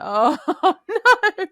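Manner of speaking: embarrassed laugh-groan. Retold or imitated. [name] caught out in front of coworkers, laughing groan. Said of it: "I'm like".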